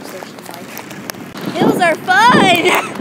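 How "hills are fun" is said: slow, high and loud yell; excited shout. Real, not acted. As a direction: belt it out going uphill. Riding a bike up hills.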